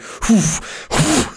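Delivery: high, breathy gasps of a burnt mouth; mock chili gasp. Teasing co-host who sounds like he ate chili, mock gasps. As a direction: puff like a hot pepper just hit. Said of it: "you're like".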